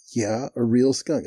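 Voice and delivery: slow, falling, matter-of-fact answer; emphatic confirmation. Retold or imitated. Cotton confirms to the doubting manager, slow and emphatic. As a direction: confirm it slowly, landing on each word.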